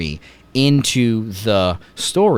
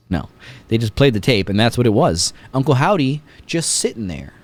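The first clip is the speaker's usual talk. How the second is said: usual speaking voice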